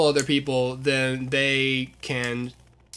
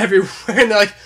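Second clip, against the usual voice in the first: normal talking voice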